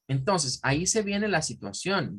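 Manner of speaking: usual speaking voice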